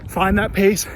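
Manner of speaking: talking mid-run, loud and breathless; speaking while jogging; while running. Real, not acted. Recording mid-run, third leg.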